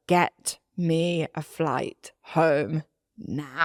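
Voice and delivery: very slow, drawn-out plea; desperate plea. Retold or imitated. Calling her dad from baggage reclaim, slow pleading. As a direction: drag each word out, begging. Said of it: "I'm like".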